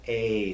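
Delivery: "A" as the vowel in they; drawn-out "A", rhyming with they; A sound. Shown as a demonstration. Teacher gives the other E sound, "A" as in they. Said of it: "like the word they".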